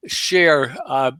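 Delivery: usual speaking voice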